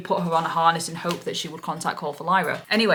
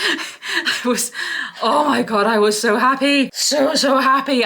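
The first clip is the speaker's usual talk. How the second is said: high, loud joyful gush; real happy squeal of relief; overjoyed; 7 semitones higher, 6 dB louder, slower than usual. Real, not acted. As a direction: burst out with real delight. Overjoyed at finding lost bird.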